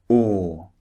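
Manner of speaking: "Or" held long, lips rounded, no R; long British "Or" without the R; long rounded or vowel. Shown as a demonstration. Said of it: "Notice the shape of my mouth".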